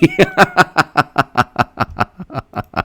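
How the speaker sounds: high laugh fading out; long, high giggling run that tails away; laughter. Real, not acted. Streamer cracking up over Cobra Kai for free, laughter.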